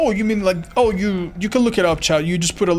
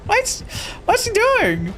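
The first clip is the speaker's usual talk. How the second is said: baffled high squeak; slower than usual. Real, not acted. Streamer baffled by the killer's odd move, high and incredulous. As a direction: pitch jumps way up in disbelief.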